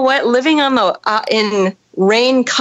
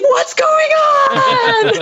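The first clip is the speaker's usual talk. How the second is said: very high, stretched-out mock alarm; waking boyfriend; much slower than usual. Boyfriend waking mid-storm, high comic panicked wail. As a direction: wail it slowly in comic bewilderment. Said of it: "boyfriend wakes up and he's like".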